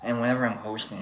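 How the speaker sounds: normal talking voice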